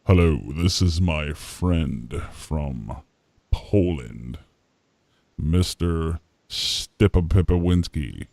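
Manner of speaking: low, slow put-on voice; drawn-out, deep comic introduction; comic foreign introducer. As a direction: introduce a friend ponderously in a low mock-formal voice. Playing a foreigner introducing a friend.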